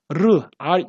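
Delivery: 르 and 알 pronounced as the English R; isolated, emphatic 르, then 알 for English R; English R sound. Shown as a demonstration. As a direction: demonstrate the R sound in 르, 알, set apart and loud. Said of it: "영어의 알에 해당하는 발음을요".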